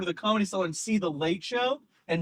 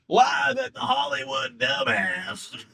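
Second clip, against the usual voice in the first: slow, put-on Southern accent, pitched up; 4 semitones higher, slower than usual. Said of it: "he says it in a Southern accent".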